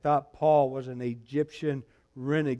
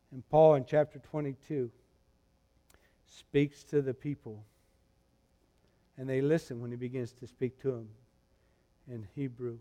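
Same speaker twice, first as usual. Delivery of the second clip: ordinary talk, nothing marked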